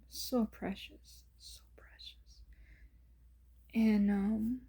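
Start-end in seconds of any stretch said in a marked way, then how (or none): 0.1-2.4 slow, breathy whisper of wonder; hushed awe. Real, not acted. Whispering to viewers over the baby's photo, hushed and tender.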